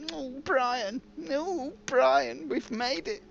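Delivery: high-pitched cartoonish gloating; silly high plotting voice; gloating game boss. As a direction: put on a high voice for a mock boss crowing to a pal. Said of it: "and, and just go".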